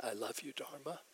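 mean it this time, quietly moved; heartfelt. Retold or imitated. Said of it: "I felt it".

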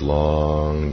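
"long" stretched out on a steady pitch; drawn-out word. Real, not acted. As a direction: draw out the vowel of "long" like a slow breath. Illustrating a long breath.

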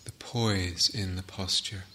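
slow, measured meditation guidance with long pauses; guided meditation. Real, not acted. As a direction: guide the sitters gently, leaving space between phrases. Leads a guided sitting meditation.

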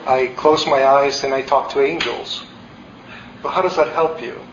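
usual speaking voice

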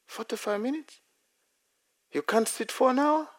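lightly voiced teacher, doubting the claim; the teacher, unimpressed by forty-five minutes, incredulous. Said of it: "He said".

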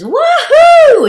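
imitated high, loud woohoo; whooping. Elder woman leaping off the platform, imitated joyous whoop. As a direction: whoop it out like a thrilled jumper. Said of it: "she literally does hang ten and says".

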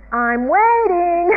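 high, loud, drawn-out singsong demand; Calvin. Retold or imitated. Calvin prodding the heavens for snow, loud impatient call. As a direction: call it upward, stretching the word slowly. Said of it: "Then he kind of says".

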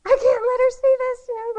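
high, loud and fast frantic cry; a four-year-old hiding her angry drawing, in a shrieking panic; panicked little girl. Retold or imitated. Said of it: "She's like".

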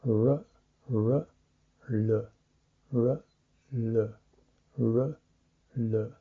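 "R" with the tongue pulled back, "L" with it forward; r versus l. Shown as a demonstration. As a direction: switch between "R" and "L" by moving the tongue. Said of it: "depends how much you pull your tongue back".

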